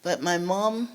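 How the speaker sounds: usual speaking voice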